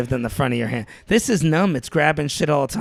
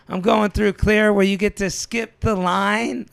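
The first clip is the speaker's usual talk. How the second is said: higher, drawn-out boast for everyone to hear; showy announcement; 6 semitones higher, slower than usual. Retold or imitated. Traveler bragging to people waiting at the airport, showy announcement. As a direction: announce it slowly, pitched up for the whole line. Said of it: "I say it all loud so they can hear me".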